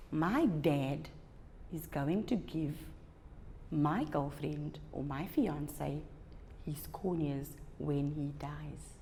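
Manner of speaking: soft, slow and falling; sad realization. Acted. Young man realizing his father will give his corneas, softly. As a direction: let it sink in quietly and slowly. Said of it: "he realized".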